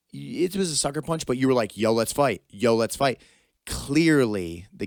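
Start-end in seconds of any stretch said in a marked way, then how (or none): 1.8-3.2 loud, punchy invitation to fight; retold challenge. Podcast host imitating a kid's challenge, loud dare. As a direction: throw down a repeated dare.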